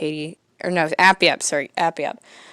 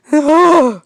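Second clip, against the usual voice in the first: loud sigh; 9 semitones higher, 7 dB louder than usual. Acted. Dad after haircut.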